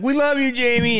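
high, sugary imitation of the lady; sweet lady voice. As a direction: mimic her sweet cheer. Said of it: "She's like".

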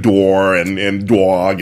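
imitating mom's drawn-out New York vowels; lightly put-on New York accent. Daughter quoting her mom, New York accent. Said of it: "just an accent".

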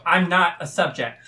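loud, forceful refusal; punchy, defiant declaration; defiant threat. Acted. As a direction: hold your ground at full voice. Character objects with daggers drawn.

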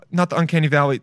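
ordinary talk

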